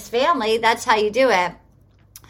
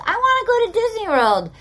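imitating a small child, higher and quicker; excited little kid; 10 semitones higher, faster than usual. Little boy on FaceTime asks his dad, imitated high kid voice. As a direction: pipe up high like an eager kid. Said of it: "The kid goes".

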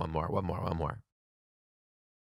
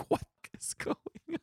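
high, squeaky whisper; baffled whisper; 14 semitones higher, 7 dB quieter, much faster than usual. Retold or imitated. Teacher led around by children, baffled whispered aside. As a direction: whisper it in bewilderment, on the edge of laughter.

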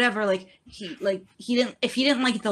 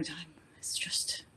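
whisper; 14 dB quieter, slower than usual. Real, not acted. Sighing over dinner time.

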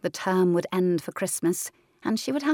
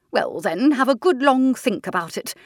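brisk aunt; 5 semitones higher, 6 dB louder than usual. Acted. Aunt Senna replies.

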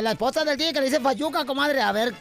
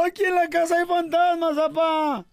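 put-on high child's voice calling dad; a kid begging his father, high and pushed; scared little kid; 5 semitones higher than usual. Child telling his dad about ghosts, high and pleading.

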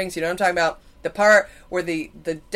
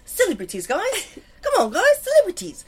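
put-on New Zealand accent; 10 semitones higher than usual. Kiwi insisting she means celebrities, high mock New Zealand accent. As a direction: squeak out an exaggerated New Zealand plea. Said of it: "the accent is real".

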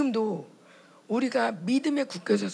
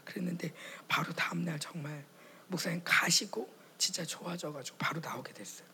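normal talking voice

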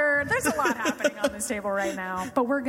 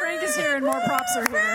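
high, loud hype intro; excited announcement; 5 dB louder than usual. Real, not acted. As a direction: announce him like a star. Announcing Frank to the audience.

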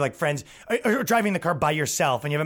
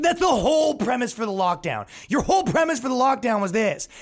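angry rant; 10 semitones higher than usual. Real, not acted. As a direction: rage at the lockdown logic. Ranting about lockdown premise.

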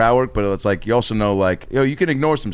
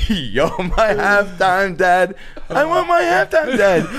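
high, drawn-out mock wail; mock whiny demand; 9 semitones higher, much slower than usual. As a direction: whine it high and stretch every word. Chanting a drunken bit from the night.

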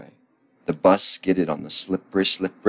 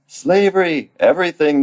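put-on loud, high-pitched children's yell; excited yell; 10 semitones higher, 7 dB louder than usual. The children yell their order to Stan, high shouting. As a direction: yell it high, each word its own outburst. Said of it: "they yelled".

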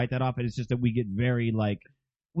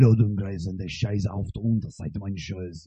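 casual German accent; 5 semitones lower than usual. Shown as a demonstration. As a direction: drop the pitch and speak German calmly. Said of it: "if you're talking casual".

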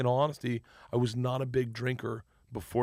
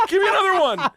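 high, loud, hungry for more; eager excitement; 10 dB louder than usual. Retold or imitated. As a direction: beg for the next word, thrilled. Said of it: "I got so excited".

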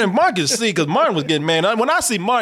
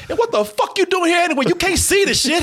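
mock retort; 10 semitones higher than usual. Imagined retort.

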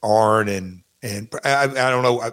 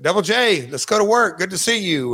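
high, upbeat greeting with a smile; cheery Pat; 8 semitones higher than usual. Retold or imitated. Wrestler imitating his boss welcoming him back to work, cheery and high. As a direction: imitate a smiling boss's welcome, pitched up, no accent. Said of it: "Pat always had a smile on his face".

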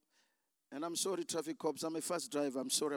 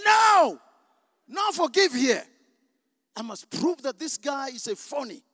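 a very loud, higher defiant shout refusing to forgive; flesh shouting; 6 semitones higher, 13 dB louder than usual. Retold or imitated. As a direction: scream it like the stubborn flesh. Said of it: "My flesh is saying".

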